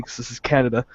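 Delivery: ordinary talk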